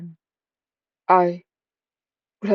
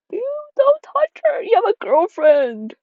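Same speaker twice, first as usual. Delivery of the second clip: much higher, louder, laughing shriek; high laughing squeal at the flirting; squealing mock outrage; 5 dB louder than usual. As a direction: squeak it out in scandalized disbelief.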